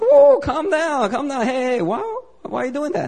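high, laughing whoa, slowed right down; half-laughing alarm, trying to calm her; startled. Retold or imitated. Speaker startled as the translator prays too aggressively.

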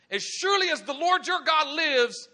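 high, loud indignant outburst; hammed-up fed-up fury; angry widow. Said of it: "And she got angry".

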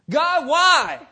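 high, loud pleading call to heaven; a sufferer crying up to God; anguished cry to God. Acted. Preacher voicing an anguished cry to God.

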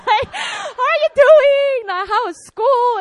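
high, loud, cheerful father's greeting; loud, high imitation of a jolly father; jolly dad. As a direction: beam it like an overjoyed dad. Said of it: "he sounded so jolly".

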